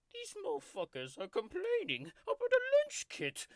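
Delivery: hammed-up tiger rage; furious rant. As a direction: explode at the complainers. Ranting about commenters.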